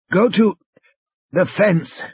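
high, halting, wheezy voice; injured man struggling to speak; weak wheeze. A narrator's reading. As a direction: push words out in broken pieces. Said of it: "almost a wheeze".

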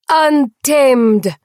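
high, loud toast Untamed!; cried out. Acted. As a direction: shout Untamed! in triumph. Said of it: "Saraya cried".

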